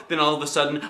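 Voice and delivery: usual speaking voice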